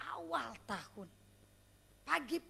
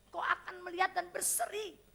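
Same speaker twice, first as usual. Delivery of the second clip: normal talking voice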